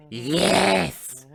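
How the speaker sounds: loud, high, stretched put-on voice; talking coin. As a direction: answer loudly as the coin.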